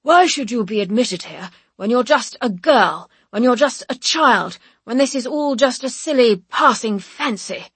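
loud, scornful examiner voice; stern board. Acted. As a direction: belittle her from the bench. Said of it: "the board asking me why".

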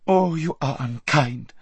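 put-on pampered girl sulking; hurt Lazareline. A narrator's reading. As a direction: reproach her friend. Lazareline reproachful.

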